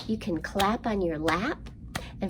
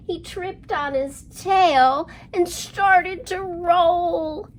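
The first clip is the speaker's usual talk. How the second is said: slow lively rhyme, louder on the roll and growl; rhyme for kids; 5 semitones higher, 7 dB louder, slower than usual. Acted. Librarian reciting counting rhyme to children, lively. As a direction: act out the lions tumbling.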